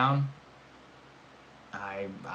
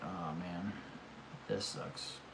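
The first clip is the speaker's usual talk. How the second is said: soft, deflated mock moping; whispered disappointment; imagined defeat; 5 semitones lower, 15 dB quieter, much slower than usual. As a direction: sag into a quiet letdown. Imagined reaction to losing.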